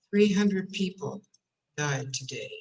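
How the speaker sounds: low, heavy tragic headline; somber news read. Shown as a demonstration. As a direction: frown and drop it gravely. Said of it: "I'm not changing my voice. I'm changing my face".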